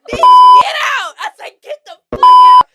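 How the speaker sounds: high, loud yell to get out; furious shout. Retold or imitated. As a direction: scream at her to leave. Throwing friend out angrily.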